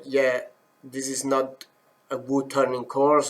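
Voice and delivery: ordinary talk, nothing marked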